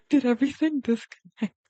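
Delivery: alarmed. Real, not acted. Streamer notices the stream has dropped, alarmed. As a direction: blurt it out in alarm. Stream suddenly drops mid-sentence.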